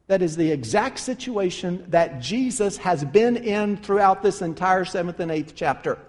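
ordinary delivery

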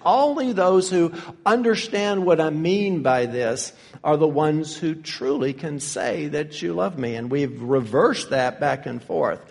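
ordinary talk, nothing marked

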